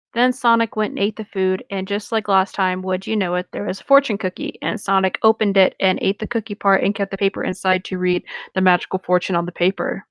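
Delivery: normal talking voice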